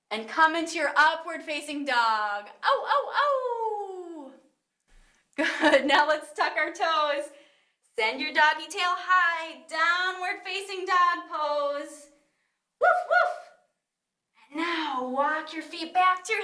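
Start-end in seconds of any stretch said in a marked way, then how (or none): 2.6-4.3 stretchy oh sounds. Shown as a demonstration. Upward dog stretch sound again. 12.8-13.3 very high, loud puppy woofs; playful barking. Shown as a demonstration. Doggy sound in downward dog.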